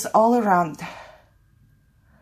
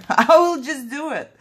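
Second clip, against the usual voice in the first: laughing; 7 semitones higher, 4 dB louder than usual. Real, not acted. Nervous about the glue working.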